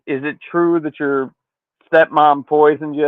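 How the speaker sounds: ordinary talk, nothing marked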